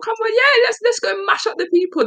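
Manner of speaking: very high, hyped Cockney rally; rally the orc mob, thrilled; hyped-up battle cry. Retold or imitated. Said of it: "I thought".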